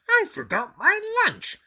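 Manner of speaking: high, loud distressed monster voice; Grover dismayed. A narrator's reading. Grover realizing he forgot his lunch, high and distressed. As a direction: wail it as Grover.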